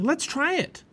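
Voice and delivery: high-pitched, eager invitation; eager urging. A narrator's reading. Girl urging boy to slide too, eager urging. Annie urges Jack to slide.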